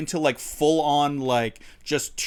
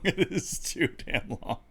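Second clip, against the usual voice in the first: laughing through the words; 3 semitones higher, 6 dB quieter than usual. Shown as a demonstration. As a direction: say it while laughing.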